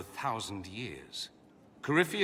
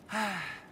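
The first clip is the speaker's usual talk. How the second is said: a soft, longing sigh; wistful sigh; 7 semitones higher, 3 dB quieter than usual. Acted. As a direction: sigh as if remembering home. Wistful about home.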